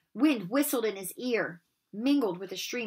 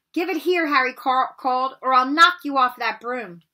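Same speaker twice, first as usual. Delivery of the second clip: loud, higher call across the air; 4 semitones higher, 8 dB louder than usual. Acted. Harry calls to Malfoy in midair, loud threat. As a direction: threaten him across the gap. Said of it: "Harry called".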